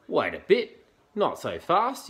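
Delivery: high, loud, indignant little creature; bristling echidna. A narrator's reading. Echidna interrupts the cook, bristling. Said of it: "he bristled".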